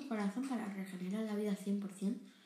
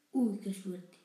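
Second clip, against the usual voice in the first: usual speaking voice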